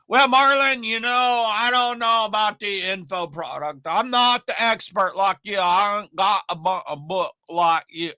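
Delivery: a hint of a country Oklahoma customer. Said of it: "I like to do that voice".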